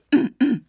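a loud demo clear of the throat. Shown as a demonstration. Guest demonstrates clearing an irritated throat, loud throat clearing. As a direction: show the dry throat with a hard ahem. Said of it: "이렇게 헛기침 하잖아요".

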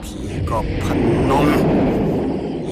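slow breathy menacing sneer; menacing hiss. Acted. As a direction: sneer it slowly, threatening. Demon lord ambushes them.

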